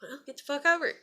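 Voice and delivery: high, quick mock dismissal; retold fed-up retort. Daughter to complaining dad, fed-up dismissal. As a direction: toss it back, exasperated.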